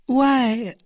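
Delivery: high, stalling "why"; flustered and caught out; hesitant, high-pitched filler; embarrassed. A narrator's reading.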